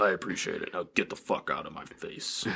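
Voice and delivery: low, quiet, deep dismissal; Hades. Acted. Father coldly sending his son away, deepest voice. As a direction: sink the voice into a softly menacing mock god. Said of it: "With, like, the deepest voice ever".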